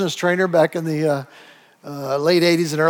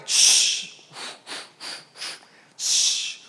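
imitated hiss of an iron's steam; 6 dB quieter than usual. Shown as a demonstration. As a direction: let out long "shh" bursts like steam. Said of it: "That's the steam".

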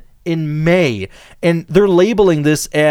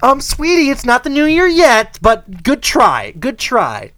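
high, louder sweet motherly correction; mock mom voice; 7 semitones higher, 4 dB louder than usual. Host imagining Meghan correcting her son, lightly sweet mock mom. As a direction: coo it like a gentle mom. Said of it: "I wish the mom would be like".

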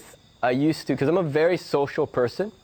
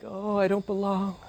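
inner hurt; 7 semitones higher, 4 dB quieter than usual. Retold or imitated. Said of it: "I got a ping of like".

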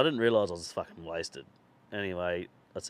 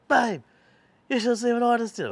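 high, loud boastful call to his wife; re-enacted drunken excitement; excited calling out; 7 dB louder than usual. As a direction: burst in bragging to your partner. Excitedly calling wife on arriving home.

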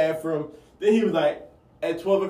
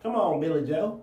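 soft mock-hillbilly call; southern twang; 5 dB quieter than usual. Shown as a demonstration. Friend calling Billy Joe in the game, southern twang. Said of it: "You got that southern twang".

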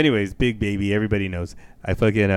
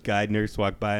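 ordinary talk, nothing marked

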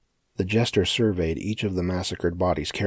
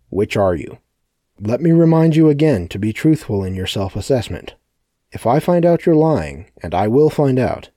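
loud, higher menacing address; loud threatening speech; 4 semitones higher, 8 dB louder than usual. A narrator's reading. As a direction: loom over them with loud threats. Sebastian threatens the crowd.

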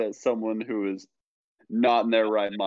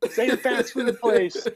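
mock yell; 11 semitones higher than usual. Teasing friend acts out him begging for a pick, mock yell. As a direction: yell it high, as a plea out the window.

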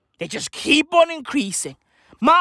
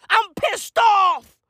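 angry; 8 semitones higher, much slower than usual. Acted.

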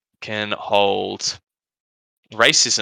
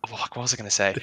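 normal talking voice

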